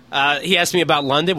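ordinary talk

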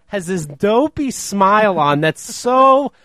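ordinary talk

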